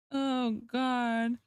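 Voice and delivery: normal talking voice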